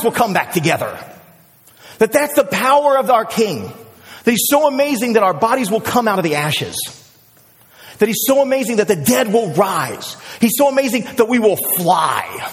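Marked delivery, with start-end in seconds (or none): none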